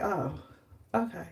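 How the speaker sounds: quiet, defeated sarcasm; deflated sarcasm. Retold or imitated. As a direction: mutter it like your day just tanked. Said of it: "I was like".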